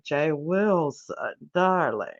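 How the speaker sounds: slow, drawn-out "darling"; slow teasing "Jay Wilson, darling"; sassy comeback. Retold or imitated. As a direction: stretch it out, sweet and shady. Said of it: "And I was like".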